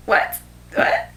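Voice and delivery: whispered, stunned double take; acted shock. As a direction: whisper it in mock astonishment. Acting out shock at the book.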